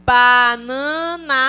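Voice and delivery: "Banana" stretched very slowly, syllable by syllable; slow syllable-by-syllable word. Shown as a demonstration. Teacher building a word for the class, slow syllables. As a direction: sound out "Banana" slowly, each syllable apart. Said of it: "pronuncie a palavra algumas vezes".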